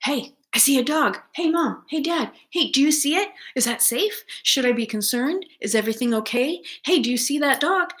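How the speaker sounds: high, nervous questions; a dog nervously checking in with its owner, high and anxious. Retold or imitated. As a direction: keep asking like a worried pup. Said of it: "it starts as".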